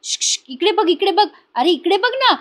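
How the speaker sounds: high, insistent attention-getting call with shushes; high-pitched "psst, look here" call; imitated calling out. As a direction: mimic trying to catch someone's eye across the room. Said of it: "आजी आवाज देत असतात".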